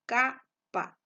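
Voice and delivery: capa with a high, loud CA; tonic syllable stressed. Shown as a demonstration. Teacher showing the tonic syllable, capa with punched CA. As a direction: punch CA in capa, then drop. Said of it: "la mayor intensidad de voz".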